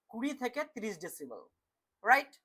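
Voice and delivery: ordinary talk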